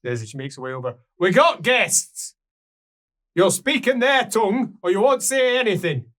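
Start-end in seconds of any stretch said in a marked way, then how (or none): 1.2-6.1 commanding dwarf. Acted. Dwarf woman ordering her kin to speak the guests' tongue. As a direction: lay down the law to the hall.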